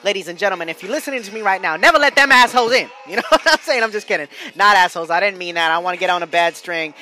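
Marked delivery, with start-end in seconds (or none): 1.7-2.9 loud, high-pitched comic warning; mock emphatic warning. Comedian warning his audience about cops, mock-emphatic shout. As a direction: holler the advice, half joking.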